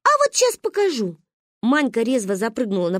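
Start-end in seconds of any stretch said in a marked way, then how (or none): 0.0-1.2 put-on high-pitched child's dare; defiant threat. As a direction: throw down the dare like a kid. (задиристо, высоким голосом)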